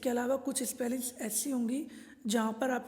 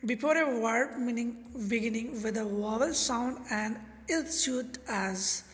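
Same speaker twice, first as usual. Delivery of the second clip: very slow, word by word reading of the rule; slow dictation; much slower than usual. Shown as a demonstration. Teacher dictating the grammar rule to the class, very slow. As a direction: dictate the rule slowly so students write. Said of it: "teacher reads rule slowly".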